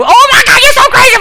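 high, loud shriek of amusement; hysterical delight. Retold or imitated. Whole table cracking up at the waiter, hysterical delight. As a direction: mimic a table in uproar, shouting high.

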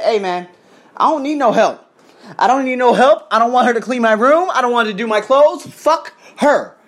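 worked-up rant, punching the curse; mad as fuck. Retold or imitated. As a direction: indignantly act out refusing help. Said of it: "I was mad as fuck".

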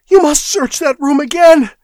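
high, loud, frantic demand; shrill and desperate, near breaking; terrified clerk begging the prefect to search again; hysterical plea. A narrator's reading. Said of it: "shrilled and cracked with hysterical excitement".

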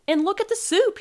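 high, loud exclamation; shouting. A narrator's reading. Sloth sees his flooded soup, shouting. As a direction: shout it in dismay. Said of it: "he shouted".

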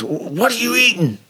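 high, whiny suspect voice; squeaky mock-indignant question; playing the irritated prisoner; mimicking the suspect. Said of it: "And he goes".

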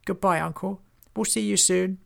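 high-pitched goblin voice; goblin farewell. Acted. Hobgob takes leave of his uncle, high voice. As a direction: call goodbye in a high little voice.

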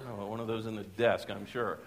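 low, soft sheepish aside; quiet comic admission to oneself; muttered aside. Retold or imitated. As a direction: drop it under your breath. His sheepish aside to himself.

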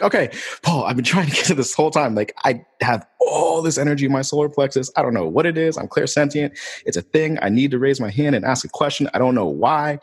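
bursting to get the words out; urgent excited plea. Retold or imitated.

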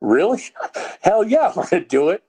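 blurting out a thrilled hell yeah; retold excited yes. Answering the trainer's job offer with excited delight. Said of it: "I said".

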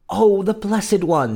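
high, slow adoring exclamation; rapturous cry. A narrator's reading. Queen holding the baby, high rapturous cry. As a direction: dwell on it with high joy. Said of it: "she cried".

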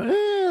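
high-pitched whiny "eh"; imitated child's whine. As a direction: mimic a child's sad little whine. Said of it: "'cause it's a whine".

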